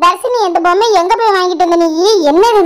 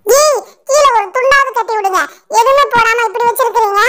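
a cheeky magic doll settling into the bath; talking doll; 5 semitones higher than usual. Acted. Doll in the bathtub telling the children to bathe it, high and cheeky.

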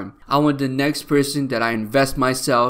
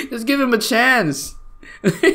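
high plea broken by laughter; laughing plea; 9 semitones higher than usual. Real, not acted. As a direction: beg through giggles. Repeating the plea while laughing.

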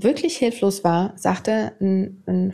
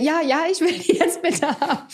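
eager yes; 7 semitones higher than usual. Retold or imitated. Eagerly accepting the bank's money offer.

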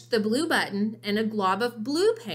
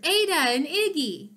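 cheerful greeting; 6 semitones higher than usual. A narrator's reading. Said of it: "they cheered".

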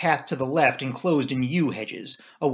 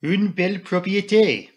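put-on higher, louder French-accented admiration; appreciative Poirot; 6 semitones higher, 4 dB louder than usual. A narrator's reading. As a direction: savour it as an appreciative Belgian detective. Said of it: "he said at last appreciatively".

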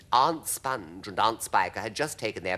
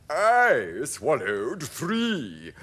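very slow, high, loud centipede; drawn-out creature boast; centipede's boastful cry; 5 semitones higher, 5 dB louder, much slower than usual. A narrator's reading. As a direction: outdo them all as a bragging centipede. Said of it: "the centipede cried".

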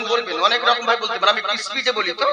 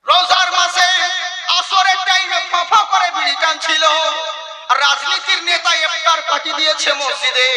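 rising preaching; 4 semitones higher than usual. Acted. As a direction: rail at them from the pulpit. Sermon rising in anger.

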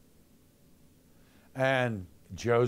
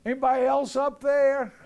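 high, louder desperate call upward; 13 semitones higher, 7 dB louder than usual. Retold or imitated. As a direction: shout up the cliff for someone else, high and loud. Man rejects God's answer, calls out.

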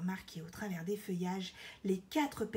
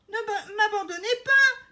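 high, very loud cry; desperate yell; 12 semitones higher, 12 dB louder than usual. A narrator's reading. Elephant being left in the pit, yelling. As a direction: howl after those leaving. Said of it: "hurle l'éléphant".

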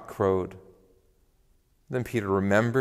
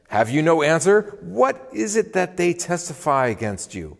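raised, higher-pitched demand for an answer; stern interrogation; 6 semitones higher, 3 dB louder than usual. A narrator's reading. High priest questioning Jesus before the council, stern interrogation. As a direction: press the accused with indignant questions.

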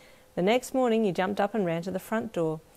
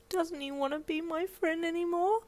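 put-on sad child; 12 semitones higher than usual. Child asking in despair.

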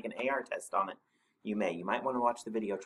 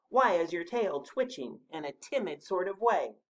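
higher small animal voice; Rabbit's voice; 8 semitones higher, 4 dB louder than usual. A narrator's reading. Rabbit asking about the twitching tail, higher voice. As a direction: ask it pointedly in a little creature's voice. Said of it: "said Rabbit".

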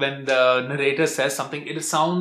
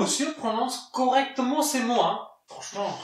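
much higher, mocking voice of a French clerk; snooty French clerk; 8 semitones higher, 4 dB quieter than usual. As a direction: play a prissy Parisian clerk apologising. Skit, same clerk voice.

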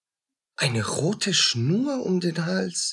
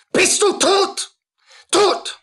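a touch high and frantic, pressing; frantic high questioning; 12 semitones higher, 4 dB louder, slower than usual. Acted. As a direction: needs to know she's dead, higher. Mörder fragt die Sterbende. (hoch, gehetzt fragend)

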